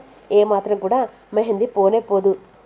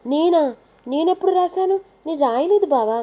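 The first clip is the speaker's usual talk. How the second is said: feigned surprise; 7 semitones higher than usual. Acted. Wife feigns innocence, denies writing.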